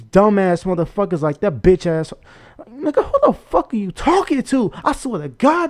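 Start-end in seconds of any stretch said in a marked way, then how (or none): 2.7-5.7 real anger, voice shooting up high; high-pitched, exasperated outburst; heated. Real, not acted. Ranting at the man who threatened him, genuinely heated. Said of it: "this shit got me heated".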